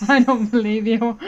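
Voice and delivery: laughing through the words; can barely get it out for laughing. Real, not acted. Laughing at a date mix-up.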